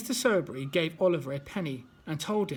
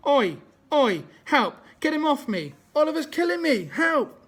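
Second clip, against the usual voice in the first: much louder, higher panicky yelling for help; bully on the floor yelling for help, higher and louder; snivelling cries for help; 8 semitones higher, 7 dB louder than usual. Acted. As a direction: howl for rescue like a cowardly bully. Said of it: "Snivelled Noah".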